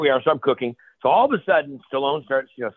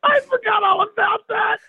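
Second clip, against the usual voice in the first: delighted laughing. Real, not acted. Host recalls funny story.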